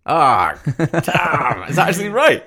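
real laughing outburst at guessing wrong; surprised dismay. Real, not acted. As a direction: react loudly, caught out and amazed. Loses the guess, reacts.

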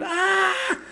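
schoolboy screaming in the bathroom. Acted. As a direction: scream in horror at his ears. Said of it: "he's screaming".

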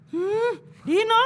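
stern mother. Retold or imitated. Mother catching son at computer.

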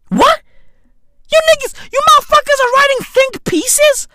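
very high-pitched, outraged disbelief; incredulous squeal. Acted. Comedian berating a newspaper over think pieces, high-pitched incredulity. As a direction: shoot way up high in mock disbelief.

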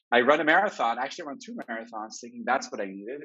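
usual speaking voice

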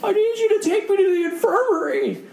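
high, whiny kid impression; sixth-grade boy. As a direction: plead in a put-on little-boy squeal. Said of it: "this little sixth grade guy".

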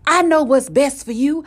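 loud, high, emphatic telling-off. Retold or imitated. Acted voice finally insisting loudly.

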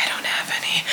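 breathy whispered joke to herself; whispered aside. Real, not acted. Quick self-deprecating aside.

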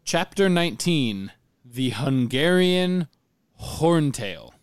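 slow, loud title announcer; chapter announcement. Acted. Host announcing the next chapter, slow announcer voice. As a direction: draw out the title grandly and loudly.

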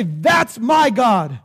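slow, forceful shouted declaration; call to repeat. Shown as a demonstration. Preacher giving followers of Jesus words to say, forceful call to repeat. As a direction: lead the crowd, hammering each word slowly. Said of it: "I want you to say that".

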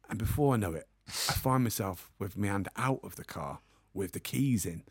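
ordinary delivery

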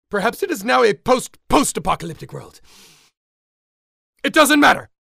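ordinary delivery

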